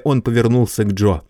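ordinary delivery

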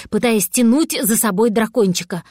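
ordinary delivery, nothing marked

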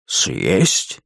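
low, questioning dragon voice; baffled big beast; dumbfounded dragon. A narrator's reading. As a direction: echo it back in deep disbelief. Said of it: "от удивления дракон".

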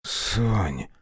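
barely a rasp from a dying man; wounded Mitya calling Sonka, wheezing; hoarse wheeze. Acted. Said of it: "просипел Митя".

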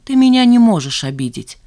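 emphatic defiant retort. A narrator's reading. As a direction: declare it boldly, daring the listener. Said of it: "вызывающе произнесла Жанна".

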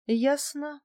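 ordinary delivery, nothing marked

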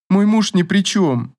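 drawn out through a light sniffle; sniffling. A narrator's reading. Katya defends her marriage to Averin, sniffling. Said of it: "шмыгнула она носом".

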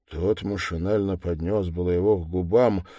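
plain reading voice

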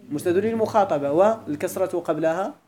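ordinary talk, nothing marked

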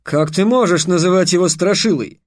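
teacher feigning outrage at a pupil; indignant exclamation. A narrator's reading. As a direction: cry out in indignation. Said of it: "негодующе воскликнул".

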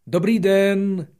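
high, loud, slow call into the mountains. Acted. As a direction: shout hello across the peaks. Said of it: "zvolal na zdař Bůh". (volání do hor)

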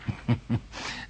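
a short breathy scoff of a laugh; soft contemptuous chuckle. Acted. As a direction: laugh it off, dismissive. Professor scoffs at the planet-eater.

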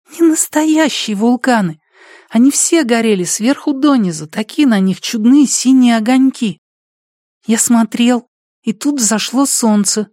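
put-on high child's voice; small boy telling a dream; little boy voice. Boy tells his dream.

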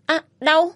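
sharp high yelp of pain; cry of pain. Acted. Woman yelps in pain as he twists her ankle. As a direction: yelp as the bone cracks. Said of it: "Tôi la lên".

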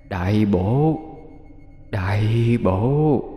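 slow, soft, eerie mutter of a satisfied demon. A narrator's reading. Demon muttering over the soul it swallowed, slow and eerie. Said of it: "rồi lẩm bẩm".

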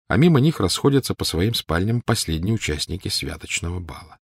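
plain reading voice

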